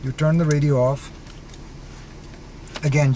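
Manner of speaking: ordinary delivery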